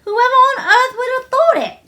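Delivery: high, loud cry of amazement; excited exclaim. Acted. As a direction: burst out in wonder. Delighted child exclaims.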